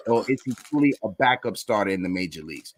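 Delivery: usual speaking voice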